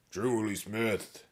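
slow, scratchy calling out. A narrator's reading. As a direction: call the name in a scratchy voice. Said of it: "a scratchy voice called out".

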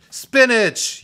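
high, loud gleeful class yell. A narrator's reading. Zombie class answers the teacher, yelling. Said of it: "yelled the zombies".

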